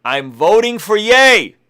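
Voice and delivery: proclaiming the tweet to his viewers, slow and emphatic; emphatic announcement. Shown as a demonstration. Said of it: "this is what I said".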